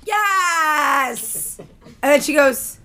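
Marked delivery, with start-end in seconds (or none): 0.1-1.6 long drawn-out campy yes; stretched-out mock delight; flamboyant drunk man. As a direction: imitate a gushing drunk stranger.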